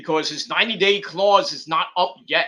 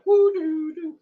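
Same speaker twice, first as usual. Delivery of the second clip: much higher, spooky lantern hum; eerie hum; 11 semitones higher, slower than usual. Retold or imitated. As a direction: hum like a haunted lantern swinging. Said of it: "with the Lantern going".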